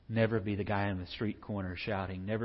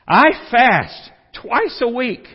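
slow, high, loud boast to God; boastful praying; 13 semitones higher, 16 dB louder, slower than usual. Acted. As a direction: proclaim it to impress God. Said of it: "Like he's trying to impress God".

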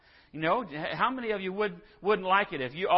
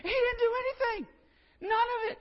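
high indignant whine; 9 dB louder than usual. Retold or imitated. Retelling Naaman's outrage.

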